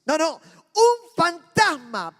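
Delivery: frightened disciples on the boat, a panicked shout. Acted. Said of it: "Los muchachos gritaron".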